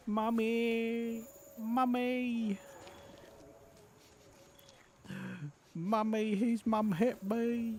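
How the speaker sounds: put-on high, slow whimpering little boy; whiny child. Mama's boy cries to his mother about being hit. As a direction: whimper for mommy like a hurt child.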